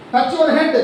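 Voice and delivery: game leader shouting an instruction; called-out command. Real, not acted. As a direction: call it out to the whole class. Teacher calling commands in game.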